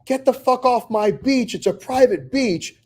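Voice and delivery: high, slow mock yell at trespassers; playful shout from the porch. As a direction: holler it out across the sand, half joking. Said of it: "I start yelling out".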